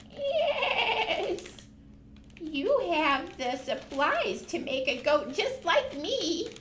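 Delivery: high, fast, playful goat puppet voice. Acted. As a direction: chatter like a greedy little goat.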